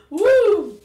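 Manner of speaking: loud, high woo; excited whoop. Real, not acted. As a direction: whoop at the sour kick. Sour candy hits.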